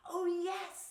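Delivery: high, loud, excited cheer; thrilled raccoon exclamation; eager agreement. A narrator's reading. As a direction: jump at the idea.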